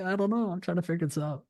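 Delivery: high-pitched, helpless imitation of yourself; hammed-up bewildered protest; mock flustered reply. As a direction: answer the scolding in a high, defensive little voice. Acted flustered reply to the friends.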